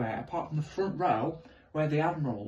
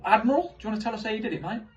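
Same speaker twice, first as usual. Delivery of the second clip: cheeky address; 7 semitones higher, faster than usual. Retold or imitated.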